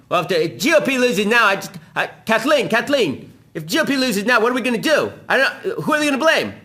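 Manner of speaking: high, loud mock panic of a rattled TV pundit; mock Chris Matthews. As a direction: squeal it out like a flustered host losing the election. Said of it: "here's Chris Matthews saying".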